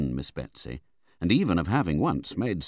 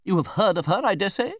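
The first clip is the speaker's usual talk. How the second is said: high, loud, lofty question; imperious aunt; 13 semitones higher, 6 dB louder than usual. A narrator's reading. Visitor questions the young widow, imperious. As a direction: press the point, high and commanding.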